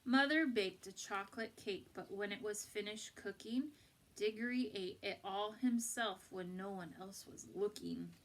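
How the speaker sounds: usual speaking voice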